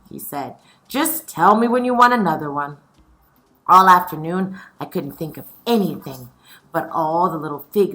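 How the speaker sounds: lively read-aloud storytelling; story reading. A narrator's reading. Teacher reading a picture book aloud to her scholars, lively.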